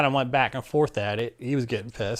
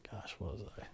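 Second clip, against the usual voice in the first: a quick breathy aside under the breath; whisper to himself; 18 dB quieter, faster than usual. Real, not acted. Telling a friend a story, pausing to whisper to himself. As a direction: whisper it while trying to remember. Trying to recall own age.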